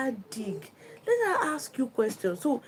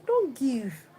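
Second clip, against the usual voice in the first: ordinary talk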